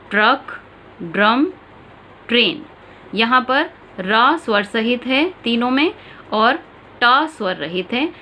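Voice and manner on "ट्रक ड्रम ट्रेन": careful demonstration of "ट्रक ड्रम ट्रेन"; clear pronunciation demo. Shown as a demonstration. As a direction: say "ट्रक", "ड्रम", "ट्रेन" one by one as a teacher showing the र cluster. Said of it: "उच्चारण देखिए इसका".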